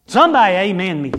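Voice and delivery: loud, quick call to the congregation; preacher's brisk, forceful prompt; calling for amen. Real, not acted. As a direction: demand an amen, punched out. Preacher calls for congregation's response.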